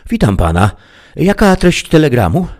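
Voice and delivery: high, lifted clerk's voice; woman clerk. A narrator's reading. Telegraph clerk greets the stranger, high voice.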